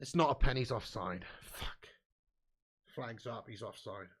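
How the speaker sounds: low, quiet, dejected mutter; deflated. Real, not acted. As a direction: sink into resignation as the flag goes up. Realises the penalty hope is gone.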